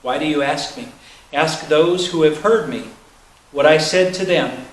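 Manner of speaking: normal talking voice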